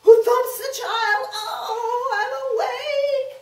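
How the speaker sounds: put-on high, loud wailing child; crying child. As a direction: whine like a woken child.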